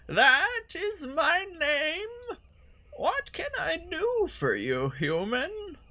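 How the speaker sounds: put-on high, slow quavering old woman; old lady voice. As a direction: play her as a frail old lady. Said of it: "do an old lady voice for her".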